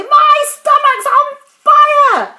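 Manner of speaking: high, very loud wail of alarm; frantic shout. A narrator's reading. Grandma feeling the medicine burn, frantic shout. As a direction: shout it high at full force. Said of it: "she shouted suddenly".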